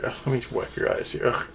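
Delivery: whispered mock-disgusted aside. Wiping pretend Lana's tears, a whispered groan of disgust. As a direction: breathe it out, grossed out but helping.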